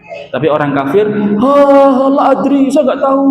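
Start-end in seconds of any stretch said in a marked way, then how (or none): 1.4-3.3 high, helpless clueless whine; the lost soul who knows nothing; dazed unbeliever. Acted. Unbeliever answering the angels. (orang kafir yang bingung, suara tinggi memelas)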